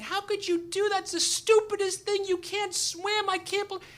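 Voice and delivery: very high-pitched scolding tirade; shrieky, comic telling-off; mock screaming. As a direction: imitate a wife screaming at her husband. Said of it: "then she starts screaming".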